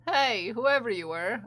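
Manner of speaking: ordinary talk, nothing marked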